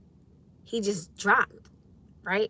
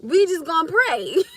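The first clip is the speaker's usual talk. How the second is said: higher, louder and slower, laughing through each word; laughing punchline; 8 semitones higher, 6 dB louder than usual. Real, not acted. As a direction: stretch the line out while cracking up. Repeats punchline, cracking up.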